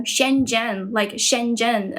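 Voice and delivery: slow, mimicked Western "Shenzhen"; Westernised pronunciation. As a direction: draw out "Shenzhen" as non-Chinese speakers say it. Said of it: "why people pronounce".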